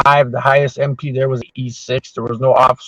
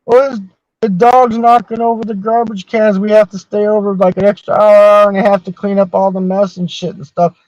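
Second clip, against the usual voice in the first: higher, whiny complaining cooks; griping cooks; 6 semitones higher than usual. Retold or imitated. Retelling how the cooks griped to him, a mild whiny higher voice. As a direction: gripe about cleaning up the mess.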